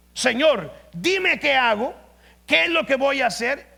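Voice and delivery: loud, high, pleading cry to God; fervent plea. Acted. Elijah begging the Lord for guidance, loud fervent cry.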